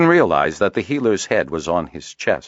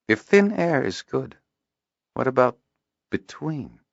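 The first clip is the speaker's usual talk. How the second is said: musing; 9 semitones higher than usual. A narrator's reading. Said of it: "Kindon mused".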